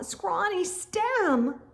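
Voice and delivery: ordinary delivery, nothing marked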